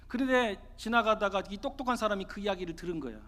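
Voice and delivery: plain reading voice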